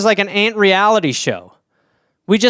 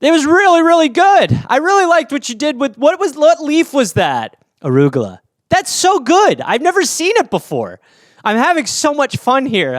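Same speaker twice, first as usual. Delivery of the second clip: gushing judge; 7 semitones higher than usual. Acted. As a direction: rave without any criticism. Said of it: "me as a judge".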